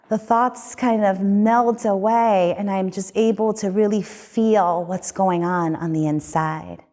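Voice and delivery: usual speaking voice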